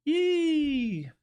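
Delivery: high happy yelp; excited yelp. Real, not acted. Trader cheering as the price drops, high yelp. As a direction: let out a gleeful yee.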